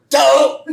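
loud, high "D'oh!"; cartoon yelp. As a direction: imitate a cartoon dad tripping, short loud cry. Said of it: "and they both go".

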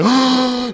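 sharp acted crowd gasp; horrified gasp. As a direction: suck in air in mock horror. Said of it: "and they're like".

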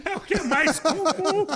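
high, loud, through real laughter; laughing. Real, not acted. Comedian agreeing with host about bad jokes, laughing. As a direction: agree while cracking up.

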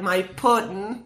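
slow, lightly voiced cooing Harley; a hint of Harley's drawn-out coo; Harley Quinn voice. Fan imitating Harley cooing at her boyfriend, lightly voiced. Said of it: "I love the way she's like".

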